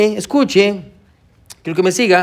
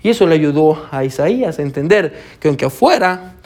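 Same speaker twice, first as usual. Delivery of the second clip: ordinary talk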